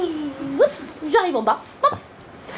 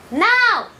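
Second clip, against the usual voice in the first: shouted command; 6 semitones higher than usual. Retold or imitated. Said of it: "Brian lui a crié".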